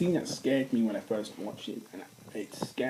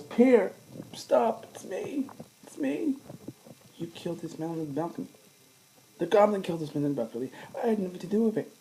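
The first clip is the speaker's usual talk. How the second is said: much higher, pleading villain voice; pleading Norman; 7 semitones higher, 4 dB louder than usual. Retold or imitated. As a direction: beg like a cornered father figure.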